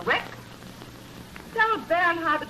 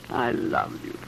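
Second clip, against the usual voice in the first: breathy whisper of love, barely voiced; lovingly whispered, almost silent; whispered confession; 11 semitones lower, 3 dB quieter, slower than usual. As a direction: whisper it as a tender secret. Tender confession to husband.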